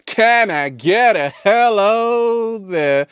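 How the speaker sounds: high, slow drawn-out call; hammed-up hello call; catchphrase call. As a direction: stretch it out like a showman's catchphrase. Catchphrase called out to audience.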